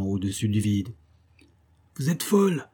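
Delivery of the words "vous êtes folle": high-pitched exclamation; narrator's restrained cry, pitched up; cried out. A narrator's reading. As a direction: call it out in alarmed disbelief. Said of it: "cria le matelot".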